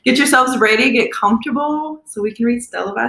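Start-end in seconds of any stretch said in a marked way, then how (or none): none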